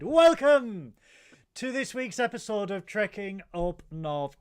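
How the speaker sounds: big booming welcome. Acted. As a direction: belt out the welcome. Grand host welcome.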